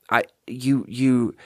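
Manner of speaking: ordinary talk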